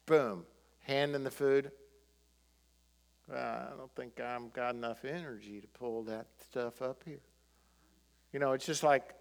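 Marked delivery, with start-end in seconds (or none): 3.3-7.2 low-energy Southern mumble, lower and much quieter; lazy Southern sluggard. Acted. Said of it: "I don't know why he talks Southern, the sluggard".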